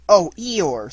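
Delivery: a sorry little exclamation; feeling sorry. A narrator's reading. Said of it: "feeling very sorry already".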